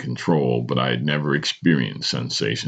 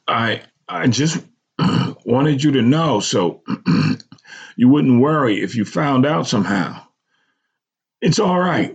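choked up; 11 semitones higher, 3 dB louder than usual. A narrator's reading. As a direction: hold it together for mother. Son reassures mother through tears.

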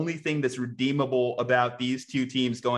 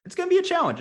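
sudden high squeak of a voice; a joking falsetto aside; mock squeal; 4 dB louder, much faster than usual. Playful squeal before serious restate.

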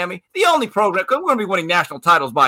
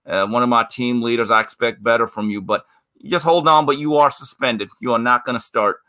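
much lower, measured disappointed coach; stern coach; 10 semitones lower than usual. Retold or imitated. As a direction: deliver the suspension like a stern coach. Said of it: "and Jimmy goes".

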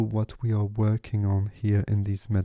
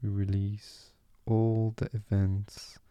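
guided meditation. Acted.

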